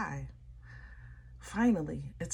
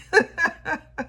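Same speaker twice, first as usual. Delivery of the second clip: high laugh; 11 semitones higher, 6 dB louder than usual. Real, not acted. Overjoyed reading for Sag.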